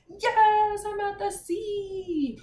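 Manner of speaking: high, loud cry of joy, then drawn out slowly; excited. Shown as a demonstration. Teacher acting Sophie jumping out of bed, overjoyed. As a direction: burst out with delight at the sea. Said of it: "jumping out of bed like".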